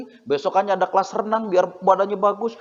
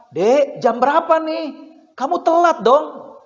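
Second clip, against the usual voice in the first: raised high, drawn-out parental nagging; mock morning yell; 7 semitones higher, 4 dB louder, slower than usual. Mother hurrying her child for school, mock morning yell. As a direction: call out slowly and high to a child running late. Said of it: "yang terdengar adalah teriakan".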